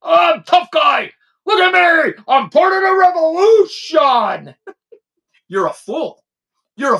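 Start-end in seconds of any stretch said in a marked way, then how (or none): 0.0-4.5 higher, shouted whiny tough-guy parody; mock him as a blustering fake revolutionary. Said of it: "You're a big tough guy now".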